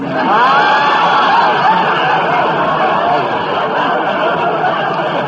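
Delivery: long loud cackle; loud laughing shout. Acted. Big audience-style laugh.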